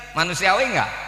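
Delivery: usual speaking voice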